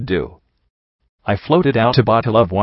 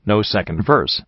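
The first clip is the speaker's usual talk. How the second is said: plain reading voice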